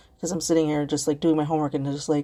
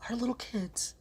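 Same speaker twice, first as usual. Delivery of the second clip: whisper; 5 semitones higher, 8 dB quieter than usual. Real, not acted. Grim fact about child slaves.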